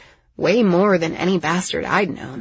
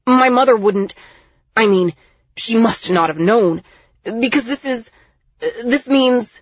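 agitated, louder, halting protest; stammering; 5 semitones higher, 7 dB louder than usual. A narrator's reading. Miles reeling from an execution order, stammering. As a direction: stammer it out, breaking off mid-thought. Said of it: "as he stammered".

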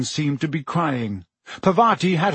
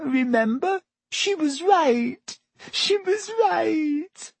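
very high, drawn-out tearful wail; tragic; 12 semitones higher than usual. A narrator's reading. Lavender recalls the dreaded prediction, tragic and tearful. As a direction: wail it slowly, building higher into crying. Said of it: "said Lavender tragically".

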